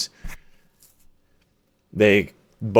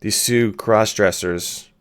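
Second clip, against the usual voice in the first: normal talking voice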